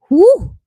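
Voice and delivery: high, loud whew; big whoop of relief after a tricky name; relieved whew. Real, not acted.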